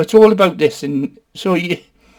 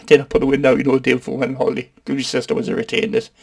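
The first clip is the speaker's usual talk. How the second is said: normal talking voice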